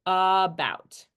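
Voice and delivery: about as a stretched "uhh-BOUT"; drawn-out schwa start. Shown as a demonstration. Teacher demonstrating the schwa in about, slow "uh-bout". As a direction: lengthen the first uh of about. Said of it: "make the uh sound".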